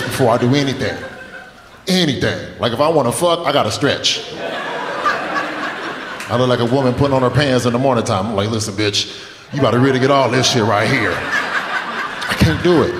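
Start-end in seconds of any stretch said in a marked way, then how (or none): none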